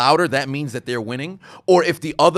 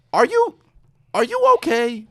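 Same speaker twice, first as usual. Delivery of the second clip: much higher, tentative check-in; mock concern; 9 semitones higher, 4 dB louder than usual. Checking on someone going crazy, high-pitched mock concern. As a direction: ask it gingerly in a raised, high pitch.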